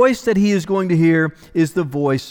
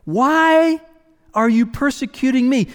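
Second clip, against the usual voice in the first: higher, lifted, inviting question; voice of invitation; 7 semitones higher than usual. Acted. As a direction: ask it as an open invitation, pitched up. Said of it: "it's the voice of invitation".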